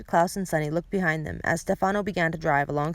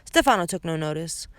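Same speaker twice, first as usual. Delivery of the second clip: plain reading voice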